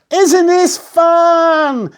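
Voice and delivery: put-on high, slow, gleeful girl; delighted call. A narrator's reading. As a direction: Katie calls to Mister Whisker. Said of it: "Katie called".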